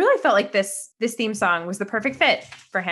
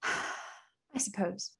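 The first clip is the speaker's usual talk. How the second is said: reluctant sigh; 12 dB quieter, much slower than usual. Real, not acted. Reluctantly agrees to act scene.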